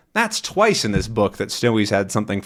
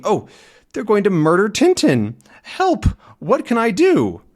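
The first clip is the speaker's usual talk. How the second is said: high, loud frantic cry for help; panicked little dog; 11 semitones higher, 4 dB louder than usual. Acted. Snowy the little dog panicking over Tintin, high frantic cry. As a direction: squeal it out in panic. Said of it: "Snowy's talking to himself as a little dog".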